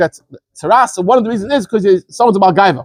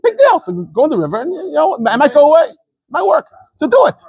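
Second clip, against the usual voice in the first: pleading servants; 6 semitones higher than usual. Retold or imitated. Servants urge Naaman to bathe.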